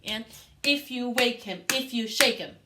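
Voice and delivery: loud and strong; strong forte chant for the class. Shown as a demonstration. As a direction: call it out strong. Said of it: "that forte line sounds like this".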